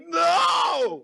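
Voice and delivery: a sudden high, loud cry of No; anguished no. Retold or imitated. Watching the dinosaur movie as a kid, a loud high no. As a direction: yell No as the baby dinosaur loses its mom. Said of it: "and I'm like".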